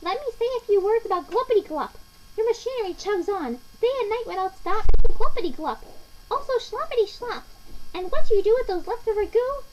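high, loud, scolding rant; riled-up Lorax. A narrator's reading. Lorax berating the Onceler about the goo, snapping. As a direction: rant indignantly in a high creature voice. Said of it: "his dander was up".

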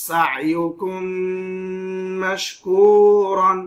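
"সা ইউ কুম মাশকুরন" slow, with a long hummed meem; ghunna on meem. Shown as a demonstration. Quran teacher showing idgham, slow nasal ghunna. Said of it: "গুণনা করে পড়লাম".

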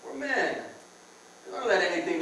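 ordinary talk, nothing marked

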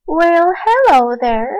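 ordinary talk, nothing marked

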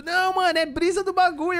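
high-pitched mock brush-off; teasing mimicry of giggly mates; mimicked laughing dismissal. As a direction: imitate amused friends waving it away, pitched high. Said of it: "Tava rindo, tipo".

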